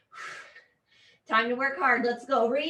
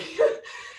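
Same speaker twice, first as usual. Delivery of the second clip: short laugh; 3 semitones higher than usual. Real, not acted. Coach laughs at burning legs.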